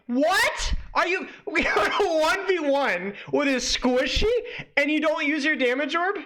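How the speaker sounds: high, drawn-out squeal of disbelief; incredulous, voice shooting up. Real, not acted. Arguing with a guide on damage orb, incredulous high squawk. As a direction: let the pitch leap up in outrage. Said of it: "Your voice just went up".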